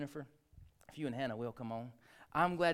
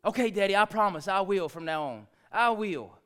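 promising daughter; 5 semitones higher, 9 dB louder than usual. Retold or imitated. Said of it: "daughter's promise, retold".

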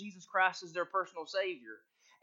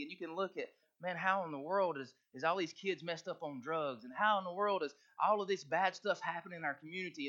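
usual speaking voice

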